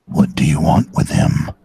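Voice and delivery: low, loud suspicious voice; gruff low voice. Pretend. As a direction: growl it at the caller. Answering the caller gruffly.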